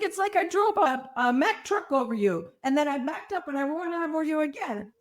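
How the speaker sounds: lightly put-on Colombian accent, amused. Patient imitates surgeon joking about the truck, Colombian accent. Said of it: "he talks with this heavy Colombian accent".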